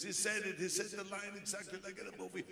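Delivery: high excited telling; excited fan. Retold or imitated. Fan tells his friend, high and excited. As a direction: rush to tell a friend. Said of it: "He says".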